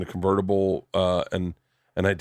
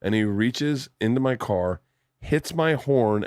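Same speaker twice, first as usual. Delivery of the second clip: normal talking voice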